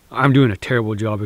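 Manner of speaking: ordinary talk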